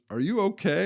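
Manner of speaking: a lightly voiced worried old boss checking in; boss asking if okay. Company owner asks the stoned guy, lightly voiced concern. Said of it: "he just goes".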